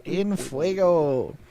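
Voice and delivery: "In fuego" drawn out slowly, savoring each word. Shown as a demonstration. Cohost echoing the catchphrase, slowly savoring "In fuego". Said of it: "as we say in the industry".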